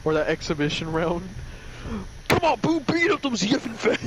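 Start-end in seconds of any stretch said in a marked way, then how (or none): 2.3-4.1 high-pitched mock ringside yell; hammed-up cheering. Mister Satan cheering Buu on in a fight, mock yelling.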